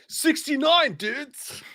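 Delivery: high stoner dude call; drawn-out surfer dude line; dude-voice quote. Retold or imitated. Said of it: "Vanessa's right, too".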